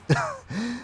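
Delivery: high, breathy mocking laugh; a short laugh at the goblin; troll scoff laugh. Troll lady laughing off the goblin, high and mocking. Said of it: "She sort of laughs at you".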